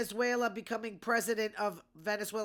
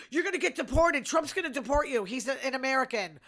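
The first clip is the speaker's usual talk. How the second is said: higher, louder heckler's taunt, easing at the end; mock angry threat; 4 semitones higher, 6 dB louder than usual. Podcaster imitating a man who punched a Democrat, mock angry. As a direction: imitate an attacker yelling threats.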